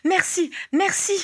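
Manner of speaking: very high, drawn-out grateful cry; effusive exclamation. A narrator's reading. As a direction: gush thanks in a high, slow voice. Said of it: "s'écria Missus Wigglesworth". (remerciements effusifs d'une voix très aiguë)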